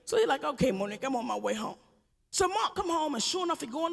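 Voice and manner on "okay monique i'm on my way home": lower, calm husband reply. Retold or imitated. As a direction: play the unbothered husband. Husband voiced.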